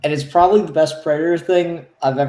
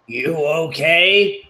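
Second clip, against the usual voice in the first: Predator voice; much slower than usual. Acted. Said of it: "the Predator just come up to him".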